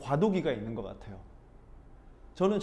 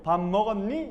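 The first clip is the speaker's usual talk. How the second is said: "먹었니?" with its end pushed up high; forced rising ending; 5 semitones higher, 4 dB louder than usual. Shown as a demonstration. Busan learner forcing the Seoul rising tune onto "먹었니?". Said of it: "끝만 어떻게 해서든 올리려고".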